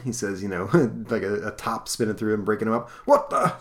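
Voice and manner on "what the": much higher sudden surprised squawk; startled yelp. Acted. Convict gets knocked apart by a speedster, startled squawk. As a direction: caught off guard, yelp it.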